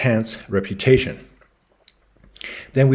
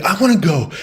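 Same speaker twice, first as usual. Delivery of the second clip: higher, louder eager plea from the husband; 8 semitones higher, 5 dB louder than usual. Retold or imitated. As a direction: beg to come along like an excited kid. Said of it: "And he's like".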